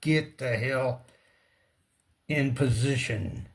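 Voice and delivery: usual speaking voice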